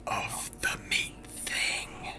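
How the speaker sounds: slow, drawn-out whisper; very soft, creeping whisper; hushed echo. Acted. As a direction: whisper it low and ominously. Finishes narrator's sentence in whisper.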